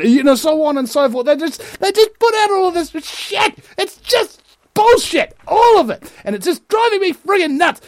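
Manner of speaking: very high, worked-up outburst; exasperated rant. Real, not acted. Commentator fed up with media scare stories, exasperated. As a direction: let the frustration send the voice way up.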